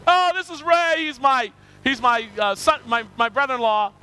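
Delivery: loud, high, gushing brother-in-law introduction; mock eager announcement. Brother-in-law introducing Ray to the whole church, mock eager. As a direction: imitate someone proudly announcing a relative, loudly.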